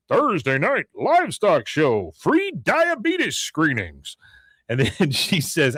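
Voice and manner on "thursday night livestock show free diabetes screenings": slow, high announcer call; mock fairground figure, slow and rising high; Big Tex. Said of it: "hear from Big Tex".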